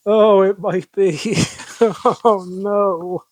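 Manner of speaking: high voice breaking into laughter; laughing. Real, not acted. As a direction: talk through a real laugh. Cracking up at the poem.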